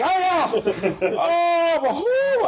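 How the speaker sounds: high, hammed-up whoops; mock cheering. Students cheering the professor's fright, high hammed-up whoops.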